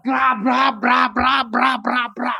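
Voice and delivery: rapid "bra" banging noises; imitated banging. Preacher mimicking the plane slamming like a ball, imitated banging. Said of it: "Kayak bola dibanting".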